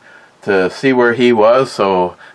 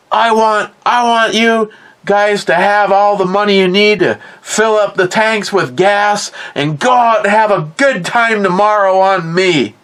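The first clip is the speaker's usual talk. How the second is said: a lightly raised, higher fond grandpa voice; generous grandpa; 9 semitones higher than usual. Retold or imitated. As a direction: hand the boys the money with a grin in the voice. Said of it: "He goes".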